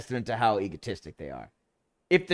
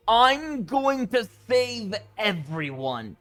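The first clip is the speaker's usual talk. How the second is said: mock-heroic, slow and higher; self-important hero; 8 semitones higher, much slower than usual. Mocking a self-righteous game character, slowed and pompous. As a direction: ham up a grand savior boast. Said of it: "guys who are self-important like that".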